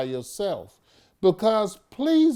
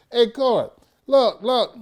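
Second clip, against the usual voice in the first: "Hey, court, look, look" higher and louder, mocking; higher, louder mock call to the court; mock casual call; 7 semitones higher, 9 dB louder than usual. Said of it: "Do we call up and say".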